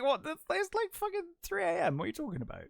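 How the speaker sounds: high, startled disbelief, a mock outburst; incredulous guard. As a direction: play the woken-up guard, squeaking with outrage. Guard woken at three AM.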